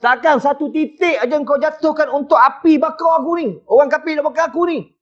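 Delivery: pleading prophet. Retold or imitated. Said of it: "Kata Nabi Ibrahim".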